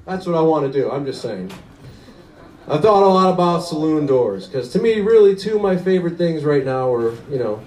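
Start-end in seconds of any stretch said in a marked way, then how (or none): none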